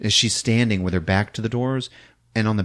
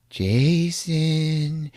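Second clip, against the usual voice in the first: high, drawn-out ghostly call; spooky wife voice; eerie calling; 7 semitones higher than usual. Retold or imitated. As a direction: beckon him with a long, lilting name. Wife calls with arms up.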